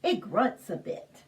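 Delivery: ordinary delivery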